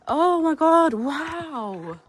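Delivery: loud high awe; thrilled exclamation; excited gasp. Real, not acted. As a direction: burst out in wonder. Awed by new place.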